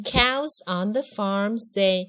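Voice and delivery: slow, drawn-out read-along with long moos; slow lyric modelling. Shown as a demonstration. Teacher modelling farm lyrics for a child, slow drawn-out chant. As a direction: stretch each moo out, loud and steady.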